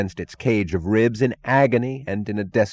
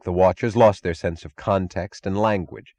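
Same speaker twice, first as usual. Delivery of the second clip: ordinary delivery, nothing marked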